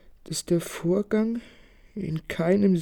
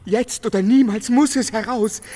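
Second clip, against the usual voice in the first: loud, urgent burst of feeling; passionate outburst; 7 dB louder than usual. Acted. Nolten in the grotto blurting his secret to Konstanze, loud and urgent. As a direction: it finally bursts out of him.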